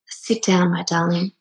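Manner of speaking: soft, tender granny; granny's gentle voice. Retold or imitated. Granny tells her tired granddaughter, softly and tenderly. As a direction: soothe her like a loving granny.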